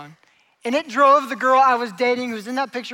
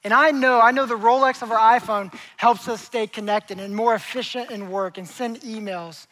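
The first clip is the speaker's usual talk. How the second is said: ordinary talk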